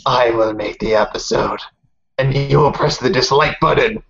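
mock villain voice. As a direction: announce it like a supervillain. Mock villain declares episode.

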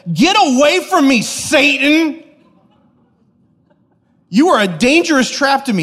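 high, loud, forceful shout; abrasive rebuke. Acted. Jesus turns on Peter, shouting an abrasive rebuke. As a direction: drive them off with a thundering rebuke. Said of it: "how abrasive that would have felt".

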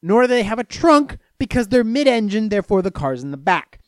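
ordinary delivery

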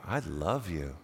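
low, soft murmur of affection; tender. Acted. Person moved by a stranger's hopes and dreams, tender. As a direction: say it softly and low, with feeling.

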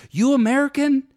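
imitating the shop owner, high and loud; store owner's question. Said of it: "he looks at me and he goes".

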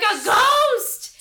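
high, loud, drawn-out cry; hammed-up spooked yelp; mock fright. Gullible groom seeing his supposedly dead bride, mock fright.

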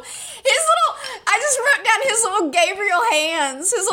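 squealing high through laughter; laughing. Real, not acted. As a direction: giggle the words out. Gushing over cute monster hands.

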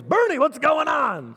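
high, loud, drawn-out holler; dad's phone greeting. Retold or imitated. Son imitating dad greeting Bernie on the phone, loud high drawl. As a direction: boom it out as dad's big hello. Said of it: "'Cause that's how he talks".

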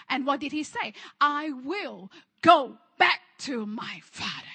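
loud, declamatory sermon delivery; emphatic preaching. Real, not acted. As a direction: proclaim it with force to the congregation. Preaches at full force.

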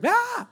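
short imitated child's scream; Winston's scream. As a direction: mimic a little boy shrieking in another room. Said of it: "I hear Winston scream".

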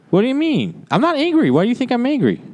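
squeaky, indignant denial; high-pitched mock anger. Asked if he is playing a part, high-pitched indignation. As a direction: protest innocence far up in pitch. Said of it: "Are you just being the part".

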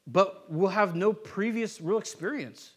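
normal talking voice

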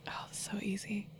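soft breathy whisper; whispered aside. Real, not acted. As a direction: whisper it to yourself. Aside to herself during question.

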